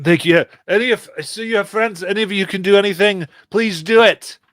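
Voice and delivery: fast, high, loud appeal for help; urgent plea. Acted. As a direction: beg them quickly over the chaos. Game master acts urgent plea for help.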